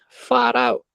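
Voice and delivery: mock American drawl of Far out; a poor American accent. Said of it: "In an American accent".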